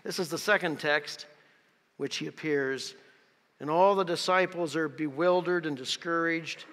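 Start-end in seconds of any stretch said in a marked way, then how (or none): none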